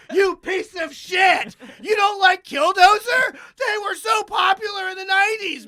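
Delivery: high, loud hammed-up outrage; mock shouting at a friend over a band; mock furious rant. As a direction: rant like an offended fan, over the top. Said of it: "I don't think, like".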